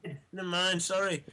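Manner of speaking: high, offhand fake sorry; mock sheepish apology. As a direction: brush it off cheekily. Mock apology to dead driver.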